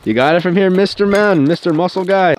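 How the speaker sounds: playful teasing call-out; loud ribbing nickname; mock hype. Real, not acted. Teasing friend loudly.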